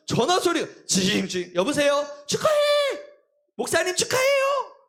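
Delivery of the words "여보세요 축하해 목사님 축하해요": much higher, cheerful caller voices; congratulating callers. Retold or imitated. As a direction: chirp the calls like excited friends. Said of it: "막 연락이 오는 거예요".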